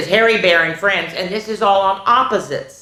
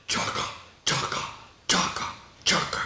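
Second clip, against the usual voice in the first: helicopter sound; 11 dB quieter than usual. Shown as a demonstration. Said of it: "I can't even do it low enough".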